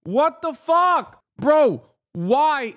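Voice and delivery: high, slow, incredulous outburst; outraged disbelief. Real, not acted. Streamer appalled at Hakeem Jeffries, outraged disbelief. Outraged reaction to played clip.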